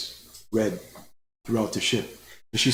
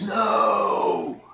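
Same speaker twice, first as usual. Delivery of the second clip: screamed no; 9 semitones higher than usual. A narrator's reading. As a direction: cry out in sudden alarm. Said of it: "She screams".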